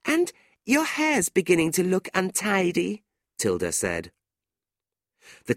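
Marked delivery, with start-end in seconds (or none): 0.0-3.0 high-pitched needling; catty. A narrator's reading. Tilda needling jealous Fanny about her hair, catty. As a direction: add the insult in a lilting high voice.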